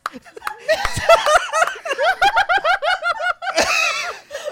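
loud high helpless laughter; howling laughter. Real, not acted. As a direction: laugh until breathless. Keeps laughing at own death.

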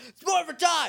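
high, loud shouted battle cry. Retold or imitated. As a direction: yell it out to the whole room. Tommy morphs in crowded room.

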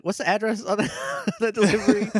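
high, laughing quote; imitating RJ through laughter. Host retells RJ asking the address, laughing. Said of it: "R J's like".

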